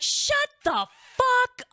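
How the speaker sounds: high, slow, loud mock scream; imitating mom shrieking with joy. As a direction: mom screams at the pregnancy news. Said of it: "screaming and, like, yelling".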